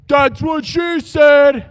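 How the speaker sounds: high, slow shouted punchline; mock shout. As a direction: belt out the old joke, drawn out.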